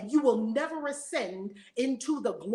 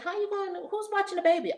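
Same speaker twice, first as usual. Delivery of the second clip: much higher mock fretting; worried doubters; 10 semitones higher, faster than usual. Friends questioning her trip, mock fretting. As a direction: imitate people nagging her. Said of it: "everybody kept saying".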